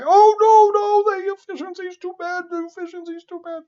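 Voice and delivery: very high squeaky whine, fading out; mock fussy engineer. Lecturer mocking an engineer complaining about efficiency, squeaky high whine. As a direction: squeal it like a panicked naysayer. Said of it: "some engineer is gonna say".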